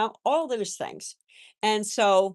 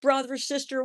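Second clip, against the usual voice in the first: put-on higher, childlike sorry voice; grandson's apology; 7 semitones higher than usual. Grandson apologizing to the earthworm's family, in a higher childlike voice. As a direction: imitate a little boy apologizing to the worms. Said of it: "He come".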